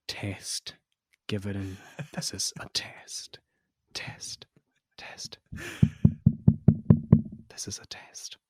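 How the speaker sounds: theatrical whispered test; whispered lyric. Pretend. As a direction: whisper it like a secret. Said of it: "do a dramatic reading of the lyrics".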